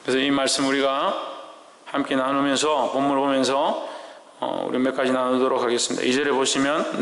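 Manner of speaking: normal talking voice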